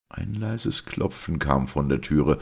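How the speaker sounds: ordinary delivery, nothing marked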